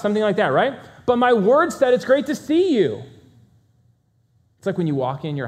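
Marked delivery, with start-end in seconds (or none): 1.9-3.0 disdainful greeting. Shown as a demonstration. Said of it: "uninterested, disdain, disgust".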